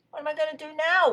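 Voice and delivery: high, loud wail; despairing wail. Acted. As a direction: wail it helplessly. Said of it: "wailed Rabbit".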